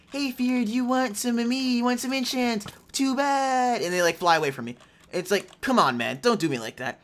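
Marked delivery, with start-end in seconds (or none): 0.1-3.8 teasing enchants voice. Pretend. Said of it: "enchants are just like".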